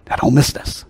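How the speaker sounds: ordinary talk, nothing marked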